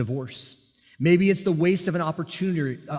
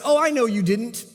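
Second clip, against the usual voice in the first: high, louder sarcastic reassurance; mock agreement; 8 semitones higher, 5 dB louder than usual. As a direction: humor him with a knowing fake agreement. Cop humoring suspect's denial.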